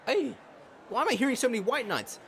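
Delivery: high, loud and fast yell; furious outburst. Acted. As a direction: shout down the whole courtroom. Outburst in the courtroom.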